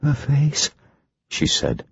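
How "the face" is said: high, hushed, haunted. Acted. Ginger in trance, high and haunted. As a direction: speak as if seeing a horror. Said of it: "in a haunted voice".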